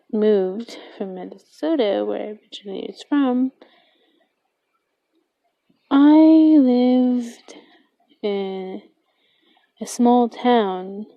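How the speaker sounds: ordinary talk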